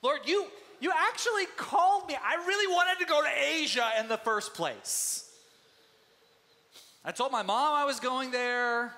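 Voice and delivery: high, loud, aggrieved whining; whiny complaint to God. Retold or imitated. Preacher imagines himself as Paul, whining to God. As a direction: play a put-upon Paul griping at God. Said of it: "I might have not reacted like Paul did".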